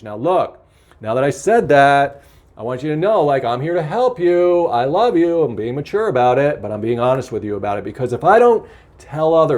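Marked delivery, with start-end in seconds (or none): none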